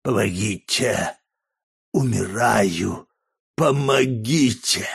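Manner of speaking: slow, strained cries for help; faint dying rasp. A narrator's reading. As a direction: drag each word out hoarsely, as if dying. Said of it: "еле слышно прохрипел". (медленно, хрипло, как умирающий)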